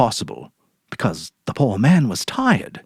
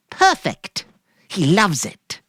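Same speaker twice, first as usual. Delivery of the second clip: much higher, airy put-on cheer; forced cheerful wife; 8 semitones higher, slower than usual. Mary ordering groceries from Sam, falsely cheerful. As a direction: play it breezy to hide guilt.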